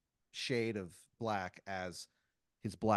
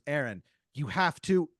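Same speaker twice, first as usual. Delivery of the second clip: urgent plea; 3 semitones higher, 9 dB louder than usual. Acted. Urgent plea as dream fades.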